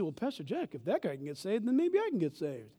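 soft, low musing, lifting toward the end; hushed, hopeful imitation; tentative quoted listener. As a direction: quietly reason it out as someone else. Voices a hesitant, hopeful listener.

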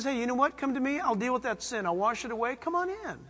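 God inviting a guilty sinner, soft and tender; gentle invitation. Acted. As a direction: invite them in gently, like a kind father. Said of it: "And he says".

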